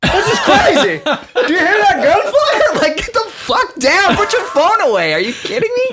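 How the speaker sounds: high, laughing mock rant; mocking people who film a shooting, high, laughing rant; mock outraged bystander.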